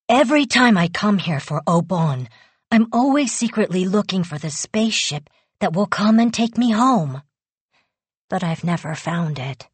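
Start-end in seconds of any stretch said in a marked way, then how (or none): none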